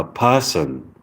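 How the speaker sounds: "person" as pah-sun, no R; British person. Shown as a demonstration. Practising British accent, "person" said like pah-sun. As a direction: open first vowel in "person", R dropped. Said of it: "So instead of person, you say".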